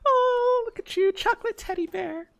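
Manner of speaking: put-on very high cutesy e-girl voice; sweet high-pitched streamer girl. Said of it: "E-grill voice".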